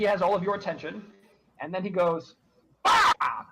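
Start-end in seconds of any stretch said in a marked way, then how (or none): 2.8-3.5 sudden high loud cry; crow shout. Acted. Crow shouting at red pigeons, high loud cry. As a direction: squawk it out high and sharp. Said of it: "and he shouts".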